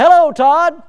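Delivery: acted-out hello, high and loud; hearty phone greeting. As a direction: greet a friend loudly down the line. Acts out his phone greeting.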